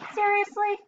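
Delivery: high, incredulous seriously; can't believe it; exasperated disbelief. A narrator's reading. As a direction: parrot fed up with being given crackers. Parrot disgusted at cracker.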